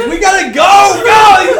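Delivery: high, loud yell to flee; retold frantic order, shouted; urgent panicked shout. Friend trying to lead the charge out, panicked shout. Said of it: "trying to lead the charge".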